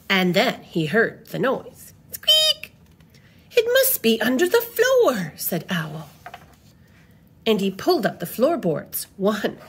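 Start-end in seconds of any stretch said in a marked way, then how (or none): 2.1-2.7 very high little squeak; sudden high-pitched peep; tiny squeak. Acted. Voicing the story's noise. 3.5-5.3 high-pitched storybook exclamation; animated, high character line; exclaiming. Acted. Owl exclaims about the noise.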